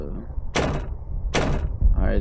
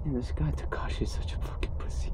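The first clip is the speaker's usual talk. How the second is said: whispered aside; 4 semitones higher, 5 dB quieter than usual. Real, not acted. Mocking aside about character.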